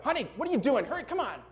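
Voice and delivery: hammed-up impatient husband calling his wife to hurry; mock yelling at wife. Husband at dinner impatiently yelling at his wife, hammed-up. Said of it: "don't yell at your wife".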